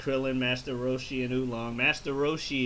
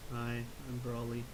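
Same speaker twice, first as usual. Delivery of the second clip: mumbling Broly; 3 semitones lower, 10 dB quieter than usual. Retold or imitated. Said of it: "this is my son Broly".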